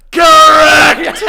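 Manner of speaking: very loud, high yell; imitated meme shout. Quoting the beer pong video, shouting. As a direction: bellow it out like the video. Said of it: "where it's just like".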